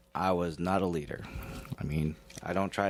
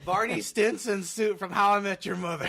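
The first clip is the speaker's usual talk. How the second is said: high, amused mock-indignant correction; laughing correction; 10 semitones higher, 3 dB louder than usual. Real, not acted. Guest correcting friend about his suit, laughing and high-pitched. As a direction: correct them while cracking up, pitch raised.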